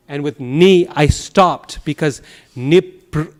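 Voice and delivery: normal talking voice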